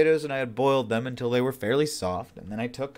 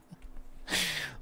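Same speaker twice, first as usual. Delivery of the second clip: silent wheezing laugh; 9 semitones higher, 9 dB quieter than usual. Real, not acted.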